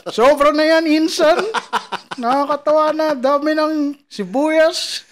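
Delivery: high, loud mock Indian accent. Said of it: "funny talaga yung Indian accent".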